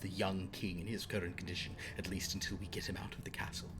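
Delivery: low, hushed figure, lightly gruff; quiet, deliberate character voice. Acted. First speaker gives Ostler orders about Goldie, lightly gruff. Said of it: "the first voice you heard speaking said".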